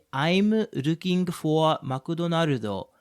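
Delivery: very slow Japanese-accented English, r for l. Shown as a demonstration. Walking up to Japanese people for directions, slow Japanese accent. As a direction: turn "McDonald's" into "Makudonarudo", Japanese accent. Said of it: "like, a Japanese accent".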